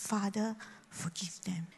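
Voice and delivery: whispered, tender prayer; hushed forgiveness. Acted. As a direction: breathe it out gently, almost silent. Jesus' attitude, hushed quote.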